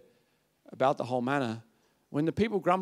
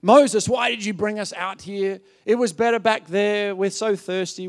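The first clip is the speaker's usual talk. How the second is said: raised, loud mock complaint; higher-pitched indignant grumble; grumbling; 4 semitones higher, 5 dB louder than usual. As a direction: imitate a crowd griping at its leader. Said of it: "They grumbled against Moses".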